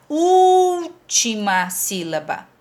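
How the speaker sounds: "última sílaba" with a long held "úl", then dropping away; emphatic, slowed "úúúl-tima sílaba"; stretched first syllable. Shown as a demonstration. Teacher stresses the key term.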